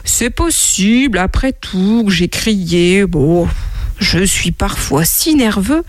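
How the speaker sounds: slow, halting concession; hesitant admission. Acted. Monkey finally admitting to the camel he shouted, slow and hesitant. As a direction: venture it slowly, stretching the words. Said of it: "il finit par se hasarder à dire".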